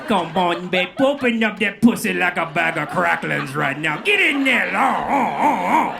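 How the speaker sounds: loud, rising Cajun come-on that ends in oh, oh; over-the-top Cajun lover yelling for it; pushy Cajun accent. Acted. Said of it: "It just comes out".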